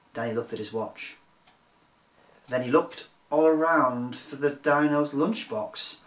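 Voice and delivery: usual speaking voice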